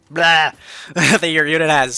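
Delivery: loud, excited blah breaking into laughter; tongue-tied blurt. Real, not acted. Player losing his words explaining abilities to viewers, excited blurt. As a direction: burst out with a loud nonsense blah. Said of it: "Uh, I'm getting excited".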